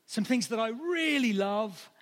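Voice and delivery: slow rising stress on really; building emphasis on really. Shown as a demonstration. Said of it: "I just thought of a few things".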